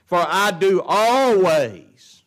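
high, loud, long-stretched key words; forceful preaching. Real, not acted. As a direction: build to a ringing proclamation.

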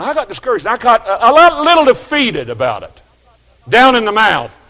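full-force pulpit delivery; preaching at full force. Acted. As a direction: preach the discouragement out to the pews. Preaching climax on discouragement.

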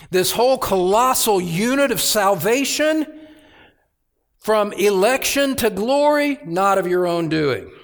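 preacher lifting to a climax; rising preaching emphasis. Acted. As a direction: proclaim it with mounting force.

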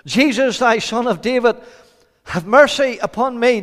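high, loud plea; preacher acting a beggar's shout; calling out above a crowd. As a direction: cry out to be heard in the street. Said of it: "the voice above the crowd".

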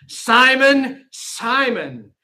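Jesus calling Simon twice, first loud then falling. Shown as a demonstration. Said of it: "when he's using a name twice".

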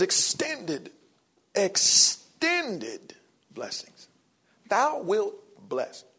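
slow emphatic stress. Acted. Preacher drawing out the promise for the congregation, slow and emphatic. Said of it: "drawing out the key words".

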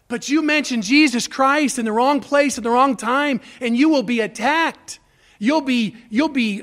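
loud, rising sermon warning; a preacher raising his voice over persecution; preaching at full force. Acted. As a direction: drive the warning home to the congregation.

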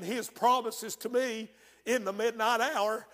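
ordinary talk, nothing marked

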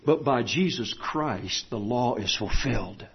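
low, soft, measured exposition; subdued pulpit voice with long pauses; hushed preaching. Real, not acted. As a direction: let the verse settle quietly. Preacher quietly expounds verse.

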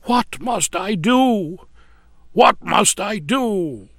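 slow, pleading groan; a penitent man groaning for help; groaned plea. A narrator's reading. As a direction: beg it out slowly, anguished. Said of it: "groaned out".

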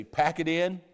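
sharp, loud telling-off; firm no-nonsense order; stern command. Retold or imitated. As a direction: snap it like a stern British parent. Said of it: "but if you say".

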